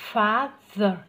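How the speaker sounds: "Father" loud, with the middle th made distinct; clear TH sound. Shown as a demonstration. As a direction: stress FA, then push out th in "Father". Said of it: "Đó, làm rõ ra nhé". ("Father" đọc to, làm rõ âm th)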